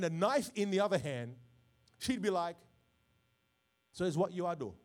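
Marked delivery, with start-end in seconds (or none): 3.9-4.7 mock Jamaican mother's accent. Sarah confronting Abraham over her son, mock Jamaican accent. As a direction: put on a playful Jamaican accent. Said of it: "I don't know why Sarah turns Jamaican".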